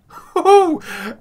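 high loud gleeful laugh; delighted laugh. Real, not acted. Player laughing at finding a diamond, gleeful laugh. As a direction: burst out laughing with joy.

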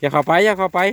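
sharp, high, loud warning shout; urgent warning call. Real, not acted. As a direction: call out fast to stop them going in. Warning someone away from ants.

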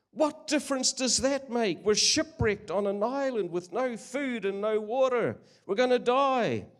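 high, loud desperate shout; panicked yell. Acted. Castaway berating his calm companion, high panicked yell. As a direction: plead in terror with someone who won't listen.

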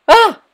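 sudden high yelp of fright; startled scream. Real, not acted. Streamer jolted by a game scare, short high scream. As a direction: shriek as the game jumps you. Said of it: "That was a terrible scream".

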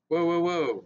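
soldiers yelling. A narrator's reading. Soldiers yelling at boy stepping forward, loud. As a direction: shout it to stop him cold. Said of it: "the soldiers yelled".

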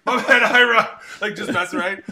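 hammed-up sorry, cracking up; laughing apology. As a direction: blurt the apology through laughter. Mock apology after joking insult.

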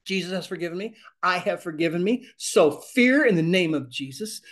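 builds to a loud shouted Fear, then firm orders; building command. Shown as a demonstration. Teacher modeling how people rebuke fear. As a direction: rise into a shout on the name. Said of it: "you get people start talking like this".

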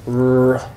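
"R" drawn out with a puff of air; aspirated R. Shown as a demonstration. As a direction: breathe through the r in "R". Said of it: "An aspirated R".

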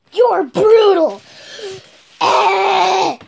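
loud, high indignant yell; angry shout. Acted. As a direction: shout it at the villain. Hero confronts villain angrily.

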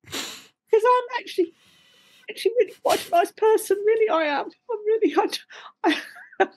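high, pleading mock-innocent voice. Actress insisting to interviewer she is nice, high mock-innocent plea. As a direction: protest sweetly, way up high.

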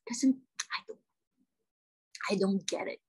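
halting, hushed confusion; bewildered whisper. Acted. Actress saying she doesn't understand, bewildered whisper.

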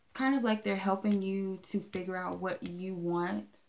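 normal talking voice

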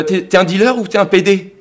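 high, loud mimicry of a partner; accusing partner. Partner challenging him about his earring, loud accusing mimicry. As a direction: fire off an outraged interrogation. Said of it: "un jour, elle me dit".

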